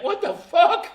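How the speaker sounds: loud, high mock outburst; explosive retold shout of disbelief; shocked disbelief. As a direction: burst out in imitated astonishment. Acting out sister's shocked reaction.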